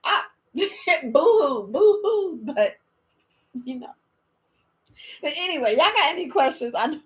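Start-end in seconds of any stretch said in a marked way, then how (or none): 0.0-2.4 hammed-up boo-hoo sobbing; fake-blubber the words; mock crying. Grandma acting out crying over her grandbaby, hammed-up. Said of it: "I actually cried".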